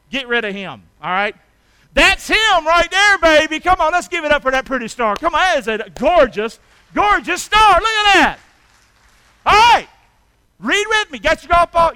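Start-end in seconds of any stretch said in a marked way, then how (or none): 2.0-11.9 high, loud, rousing preacher's cry; hollered praise, loud and high; full-force preaching. Acted. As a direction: whip the congregation up over the star.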